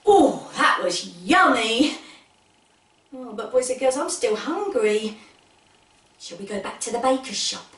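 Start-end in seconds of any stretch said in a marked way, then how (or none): none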